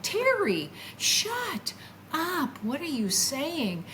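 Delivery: high, drawn-out retold rebuke; exasperated self-scolding. Telling herself off for blaming herself, exasperated. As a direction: rebuke yourself high, stretching each word.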